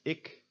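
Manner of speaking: "ik" with short ih as in fish; lax I. Shown as a demonstration. Teacher reads example word, lax "ik". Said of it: "these words are pronounced".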